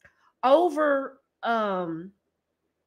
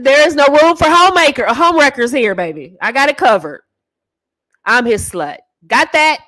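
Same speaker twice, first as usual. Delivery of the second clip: defiant wife; 3 semitones higher, 7 dB louder than usual. Acted. Wife warning off home wreckers.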